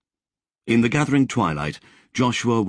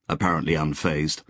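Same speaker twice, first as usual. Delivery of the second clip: plain reading voice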